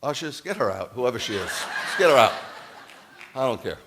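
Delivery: loud, laughing call to the ushers; laughing mock command. Real, not acted. Preacher jokingly tells ushers to remove a heckler, loud laughing mock order.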